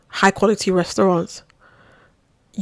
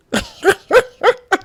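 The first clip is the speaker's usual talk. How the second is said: high, loud burst of laughter; 13 semitones higher, 5 dB louder than usual. Real, not acted. Storyteller tickled by her own aside, high laugh.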